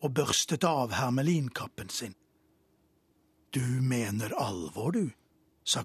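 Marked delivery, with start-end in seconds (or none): 3.5-5.1 lower, softer, sober question; king turning serious; suddenly serious. A narrator's reading. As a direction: drop the laughter, go quiet and grave. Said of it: "Kongen ble brått stille".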